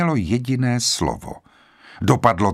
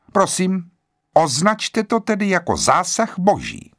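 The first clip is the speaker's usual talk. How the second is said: put-on higher, composed, prim old lady; calm interruption; 8 semitones higher than usual. A narrator's reading. Emily Brent calmly interrupting the doctor, prim and composed. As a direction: serenely cut him off. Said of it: "klidně přerušila".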